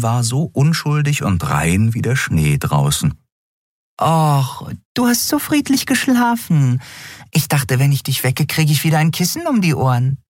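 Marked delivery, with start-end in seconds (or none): 4.0-10.2 much higher, quick teasing voice; a tiny winged creature teasing her friend; cheeky little Nelen. Acted. Nelen teasing the captain for sleeping late, high and quick.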